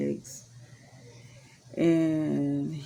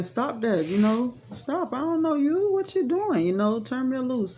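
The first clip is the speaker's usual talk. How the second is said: higher pleading, retold, pushing him off; pushing an unwanted man away, pleading higher; 8 semitones higher than usual. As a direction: plead him off in a raised voice, as she recalls it.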